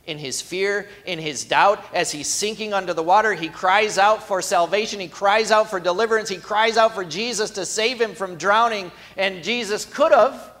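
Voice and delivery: rising sermon fervor; full-force preaching. Acted. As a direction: build the crescendo from the pulpit. Preaching builds over Peter's cry.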